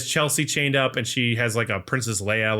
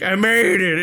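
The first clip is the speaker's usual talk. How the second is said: raised, higher retold exclamation; mock triumphant cry; 7 semitones higher, 4 dB louder than usual. Podcaster voicing John after his fall, mock triumphant cry. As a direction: imitate his triumph at surviving. Retells John landing in hell.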